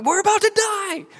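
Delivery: very high, loud frantic shout; hammed-up scream of terror; panicked yell. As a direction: yell it like the boat is sinking. Acts panicking disciples in storm.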